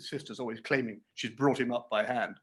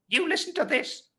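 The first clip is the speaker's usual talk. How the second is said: sharp, much higher scolding aside; severe aside. A narrator's reading. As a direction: snap a stern aside at the boy. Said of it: "in a severe parenthesis".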